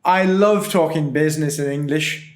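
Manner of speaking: laughing mock English accent. Shown as a demonstration. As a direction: play a jolly Brit talking business. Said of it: "can you give us a little bit of that".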